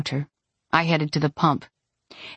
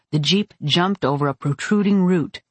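usual speaking voice

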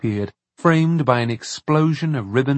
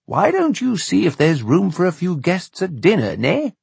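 higher, lilting indulgent uncle; fatherly uncle character; 6 semitones higher than usual. Acted. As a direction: coax a child with a lilting suggestion.

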